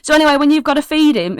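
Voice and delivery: high, fast eager chatter; excitable kid. Acted. Kid carries on about feeding his owlbear, high and hurried. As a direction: barrel on, hardly listening. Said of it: "he's like".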